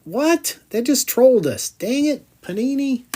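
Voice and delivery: high, loud cry of disbelief, then a grumble; a real jolt of betrayal at a dud card; shocked outburst. Real, not acted. Collector yelling at the pack maker in disbelief.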